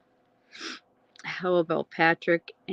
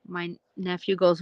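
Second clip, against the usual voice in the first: ordinary delivery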